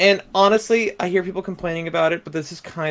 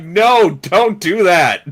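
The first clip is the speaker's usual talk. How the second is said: loud, drawn-out mock protest; emphatic quoted objection; 6 dB louder, much slower than usual. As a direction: plead it slowly and firmly, louder than usual. Quoting people objecting to casting.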